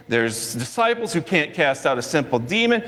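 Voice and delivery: ordinary delivery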